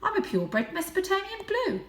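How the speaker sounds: voiced high and light as a young cat; a proud little cat boasting. A narrator's reading. Young Varjak boasting his breed to the stray.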